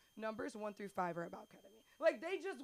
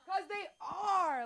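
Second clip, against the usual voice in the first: high, slow, emphatic "are"; louder admission; 9 semitones higher, 7 dB louder, slower than usual. Acted. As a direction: lean hard into "'Cause they are".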